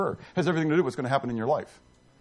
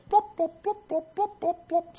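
high popping blop sounds, getting softer; raindrop noises; 12 semitones higher, 4 dB quieter than usual. Acted. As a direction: pop out the first drops of rain. Imitating raindrops starting.

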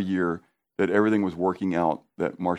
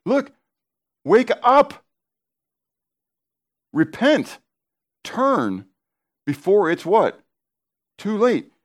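urgent call to repent; 11 semitones higher, 6 dB louder, slower than usual. Real, not acted. Preacher urging repentance, loud.

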